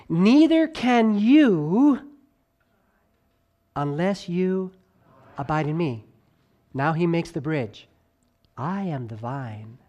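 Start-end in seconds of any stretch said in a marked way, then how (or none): 0.1-2.1 "Neither can you" very slow, higher and louder; slow emphatic phrase. Shown as a demonstration. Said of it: "voice fluctuation ... to emphasize". 8.6-9.8 "I am the vine" slow, lower and softer; slow quiet emphasis. Shown as a demonstration. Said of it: "voice fluctuation ... to emphasize".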